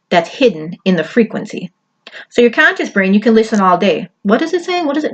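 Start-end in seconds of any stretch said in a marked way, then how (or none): 4.2-5.1 higher-pitched mock questioning; hammed-up baffled listener; puzzled listener. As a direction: act out someone straining to make out words. Acts out listener straining to understand.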